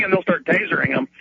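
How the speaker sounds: normal talking voice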